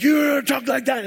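high mock shout; imitated yelling. Shelter guest squaring up for a fight, mock yell. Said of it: "And he's yelling".

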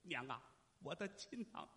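soft choked-up call to mother; hushed, halting son's plea; tearful reunion. Acted. Son kneels before long-lost mother.

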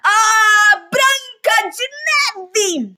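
high, loud, very slow scream of rage; outraged shriek. Acted. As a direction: shriek the name in fury.

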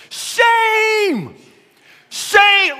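high, loud drawn-out shriek of shame; screaming old lady. Retold or imitated. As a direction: screech it like an outraged Granny. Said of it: "screaming at the top of her voice".

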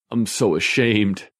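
slow, high whimpering plea. A narrator's reading. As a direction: whimper it like you're about to cry. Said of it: "in a whimpering voice".

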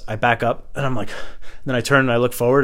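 usual speaking voice

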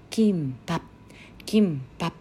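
キンパ ending with lips closed on a silent p; final p closed. Shown as a demonstration. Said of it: "最後に実は口を閉じるピーの発音があります".

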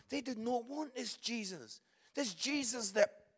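usual speaking voice

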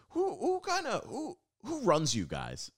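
high, slow dim-witted question; clueless comic. Retold or imitated. Comic quizzing Canadians, a high slow clueless voice. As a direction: play him baffled and dopey. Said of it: "and he goes".

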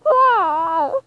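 long, high, loud cry; hammed-up wail of protest; exasperated wail. Cannot bear the fanfic.

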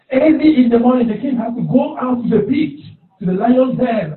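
high, loud anguished cries of the king; desperate shouting. Acted. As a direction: call into the pit in desperation. King shouting for Daniel at den.

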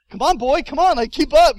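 high, loud pleading with an animal; hammed-up frantic coaxing; acted desperate urging. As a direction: egg on a stubborn mule loudly. Urging on the mule.

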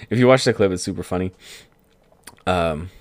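usual speaking voice

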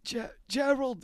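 hammed-up old man accent. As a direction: try the name out as the old man. Trying names in old man voice.

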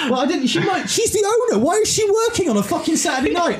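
high, outraged rant about the owner; indignant rant. Real, not acted.

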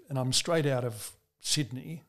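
ordinary talk, nothing marked

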